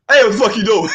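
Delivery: loud, high retold yell with a laugh in it; retold holler. Man retelling how he caught her snooping, hollered. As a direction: holler it like catching someone red-handed. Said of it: "and I hollered".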